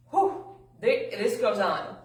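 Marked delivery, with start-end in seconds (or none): 0.1-0.4 quick high, loud puff; out-of-breath whew. Real, not acted. Speaker ends a fast reading, winded whew. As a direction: blow out air after a long read. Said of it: "I'm already a little out of breath".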